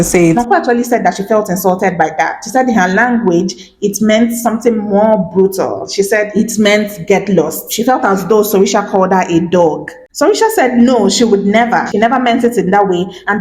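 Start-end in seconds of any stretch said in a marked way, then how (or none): none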